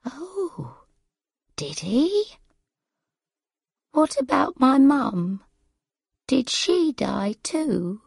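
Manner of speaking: put-on soft, high-pitched bewildered old mother; tired, confused mother. A narrator's reading. Forgetful mother learns of her parents' deaths, bewildered. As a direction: question softly and high, as a frail mother.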